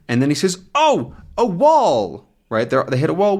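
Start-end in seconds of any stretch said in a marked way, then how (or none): 0.7-2.3 high, drawn-out cry of alarm; acted alarm. Pilot seeing a wall ahead, alarmed cry. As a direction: cry out in mock shock.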